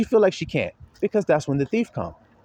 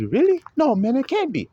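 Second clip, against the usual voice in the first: surprised disbelief; 8 semitones higher than usual. Retold or imitated. Inmate hears he's going home.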